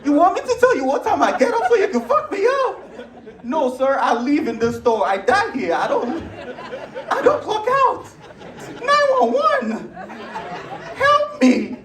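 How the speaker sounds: hammed-up terror, voice cracking high; panicked pleading. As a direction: beg for your life like a scared clerk. Panicked retold reply.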